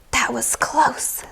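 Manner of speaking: hushed whisper to herself; whispered thought. A narrator's reading. Beatrice whispering her near miss to herself. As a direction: breathe it under her breath, relieved. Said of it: "thought Beatrice".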